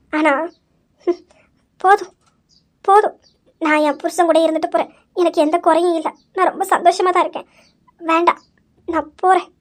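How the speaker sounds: low, soft, heartbroken voice; hurt and tearful. Acted. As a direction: hold back tears as you speak. Hurt daughter, feeling rejected.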